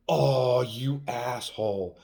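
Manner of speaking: loud, drawn-out playful indignation; mock outrage. Woman reacting to being teased about her kissing, mock-outraged. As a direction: act offended, stretching the insult.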